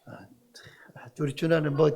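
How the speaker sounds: unvoiced whisper aside; whispering to the congregation. Real, not acted. Reacting to child's answer.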